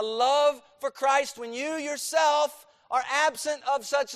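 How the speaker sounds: loud, high preaching, hammering each phrase; full-force preaching. Acted. Pastor drives the point home to his church, loud full-force preaching. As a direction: preach it hard to the congregation. Pleading with congregation about Christ's love.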